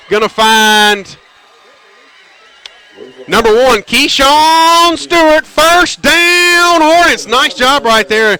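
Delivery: high, loud, stretched-out call of a big catch; excited play call. Real, not acted. Radio announcer hyping up a first down to listeners, excited. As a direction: whoop it up as the pass connects.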